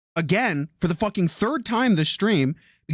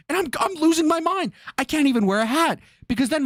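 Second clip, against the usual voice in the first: higher, rising alarm as the story builds; frantic, pitched-up storytelling; worked-up retelling; 7 semitones higher than usual. Real, not acted. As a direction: tell it like you're losing your mind. Retelling the hot doorknob scare.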